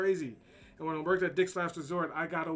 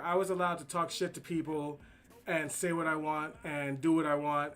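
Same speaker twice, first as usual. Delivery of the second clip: ordinary talk, nothing marked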